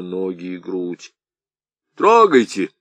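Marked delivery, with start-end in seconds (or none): 2.0-2.7 loud, high hurried call; urging on. Acted. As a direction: hurry everyone off. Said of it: "стал торопить всех Сарбалахов". (громкий торопливый окрик)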